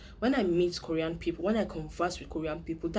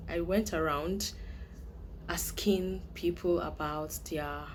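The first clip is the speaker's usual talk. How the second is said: normal talking voice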